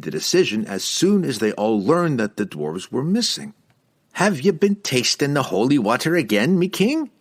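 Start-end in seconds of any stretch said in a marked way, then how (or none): 4.1-7.1 higher-pitched questioning dwarf voice; careful challenge, rising in pitch; respectful but firm. A narrator's reading. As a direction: ask the king firmly, with due respect. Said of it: "Cobble asked respectfully but firmly".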